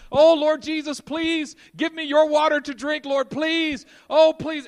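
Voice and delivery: high, loud, mock desperate begging; loud begging prayer. Believer clinging to his cistern, loudly begging Jesus for water. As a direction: plead at the top of your voice, hammed-up. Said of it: "clinging to our cisterns going".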